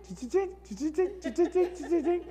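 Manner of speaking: high mock ka-ching clicks; playful imitated payphone chimes; coin mechanism clinking. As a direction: click out the coin dropping through the slots.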